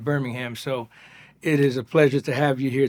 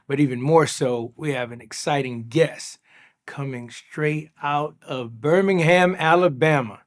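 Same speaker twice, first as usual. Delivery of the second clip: loud, upbeat show-opening welcome; hyping up the guest like an announcer; podcast host intro; 3 semitones higher, 7 dB louder than usual. Acted. Host welcomes listeners and mayor, announcer style.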